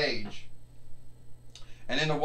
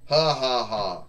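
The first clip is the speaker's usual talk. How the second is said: short gloating laugh; ha ha ha, like a cocky wrestler; mocking laugh; 5 dB louder than usual. Figure laughs after a taunt.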